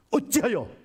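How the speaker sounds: high, punchy exclaimed challenge; pointed rebuke. Acted. Jesus chiding the disciples in the storm, pointed rebuke. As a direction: jump up in pitch to scold. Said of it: "풍자적으로 때린 거예요".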